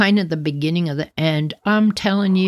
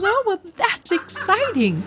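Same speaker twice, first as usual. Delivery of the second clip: much higher, lightly voiced mom; breezy mock-mom reaction, pitched up; imitating mom; 13 semitones higher than usual. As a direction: narrator imitating her mom's calm reaction to the egg. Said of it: "She goes".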